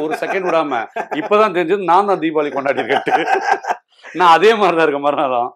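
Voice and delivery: delighted laughing delivery. Real, not acted. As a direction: laugh as you tell it. Gleeful Diwali story, laughing.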